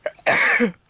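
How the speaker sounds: sharp loud coughs; hacking coughs, twice; coughing fit. Real, not acted. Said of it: "I'm gonna sneeze".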